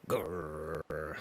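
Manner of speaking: low growl like a dog; playful growl. Pretend. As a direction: growl deep in the throat like a wolf. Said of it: "Dog noises".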